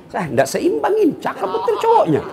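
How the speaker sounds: squeaky mock girl voice, laughing; mock high-pitched girl. Preacher mimicking a schoolgirl in a squeaky voice. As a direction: play the giggling schoolgirl up high. Preacher mocks girl's mismatched phone photo.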